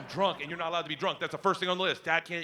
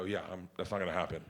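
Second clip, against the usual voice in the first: much lower, softer, quick brush-off; flat low refusal; 11 semitones lower, 8 dB quieter, faster than usual. Retold or imitated. Drunk dad refusing daughter, low brush-off. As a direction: mumble it dismissively.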